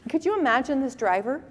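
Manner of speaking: normal talking voice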